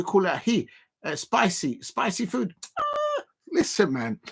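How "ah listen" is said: very high, slow ah; protesting the chat, squeaky; high mock protest. Real, not acted. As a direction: squeal the ah playfully.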